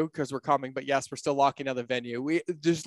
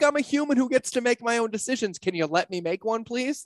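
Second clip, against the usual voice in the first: playful plea; 10 semitones higher than usual. Retold or imitated. Pleading mock argument with host.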